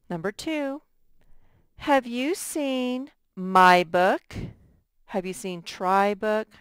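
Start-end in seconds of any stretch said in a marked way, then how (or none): 1.8-4.4 slow, clear model sentence; slow modeling read. Shown as a demonstration. Teacher reads the spelling sentence slowly to students. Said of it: "teacher models sentence to spell".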